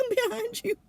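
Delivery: laughing through the words. Retold or imitated. [name] telling Marie she's stuck too, laughing. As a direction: repeat it, cracking up. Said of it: "And I said".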